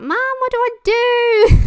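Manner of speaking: high, loud mock-desperate plea to mum; pleading whine. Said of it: "And I was like".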